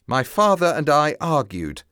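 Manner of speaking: ordinary delivery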